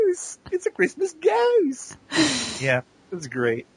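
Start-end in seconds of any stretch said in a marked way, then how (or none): 0.1-1.9 very high, slow mock child voice; little boy's voice. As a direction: squeal it slowly like an excited little boy. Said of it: "then his son Sam also did one".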